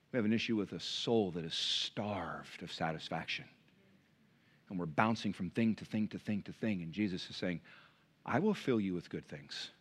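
hushed earnest sermon; quiet sincere appeal. Acted. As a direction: draw the church in close and gently. Quiet intimate appeal to church.

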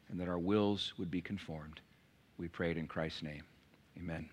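soft, hushed prayer voice; quiet congregational prayer. Acted. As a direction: pray quietly and earnestly to God. Pastor leads closing prayer.

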